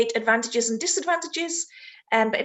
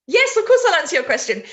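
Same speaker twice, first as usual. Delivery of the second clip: laughing reply; 5 semitones higher, 5 dB louder, faster than usual. Real, not acted. Teacher replies to student's demand.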